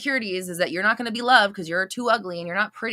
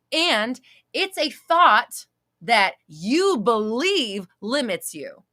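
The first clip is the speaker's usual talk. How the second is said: slow emphasis; 4 semitones higher, 4 dB louder, slower than usual. Shown as a demonstration. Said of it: "slow emphatic teaching".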